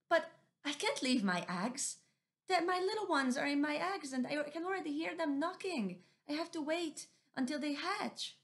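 high, firm but pleading; stubborn Missus Owl. Acted. Missus Owl insisting she must wait for the hatching. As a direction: refuse to abandon the eggs.

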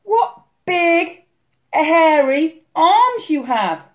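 put-on high, slow, wondering girl; said carefully. A narrator's reading. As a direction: pause on each word in amazement. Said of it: "Little Red said carefully".

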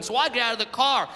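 ordinary delivery

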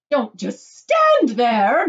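loud, slow, drawn-out scream; screamed command. A narrator's reading. Wife screams at idle husband, furious command. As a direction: scream it, stretching the words. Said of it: "screamed at Mister Gloop".

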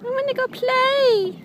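put-on toddler voice. Voicing the toddler's wish.

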